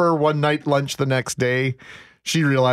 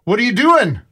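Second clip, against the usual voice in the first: raised, higher voice of a teacher catching a pupil; strict teacher; 7 semitones higher, 4 dB louder than usual. Retold or imitated. Teacher catching a boy at his locker, sharp call. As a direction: imitate a teacher calling out a student. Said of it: "he was British, and I can't do a British accent".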